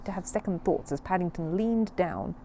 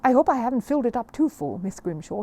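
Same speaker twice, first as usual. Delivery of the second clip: polite exclamation; 6 semitones higher, 6 dB louder than usual. Acted. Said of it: "he exclaimed politely".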